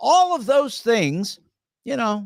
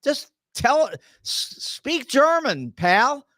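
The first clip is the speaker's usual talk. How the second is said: higher-pitched, slow scolding sneer; exasperated jab; 8 semitones higher, slower than usual. Real, not acted. Host taunting Trump to just speak German, exasperated and higher. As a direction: needle him like a fed-up neighbour.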